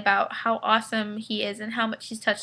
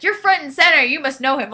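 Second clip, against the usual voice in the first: retold shout; 5 semitones higher, 7 dB louder than usual. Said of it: "he was like".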